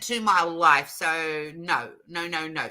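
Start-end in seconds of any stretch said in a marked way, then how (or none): none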